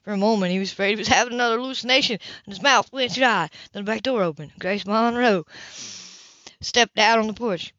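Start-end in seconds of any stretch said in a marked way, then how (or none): none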